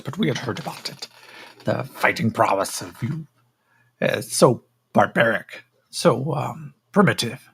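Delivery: condescending, curious alien examiner; a measured, disdainful creature sizing up its specimen; haughty creature. Acted. Creature examines the captive fighter, haughty creature voice.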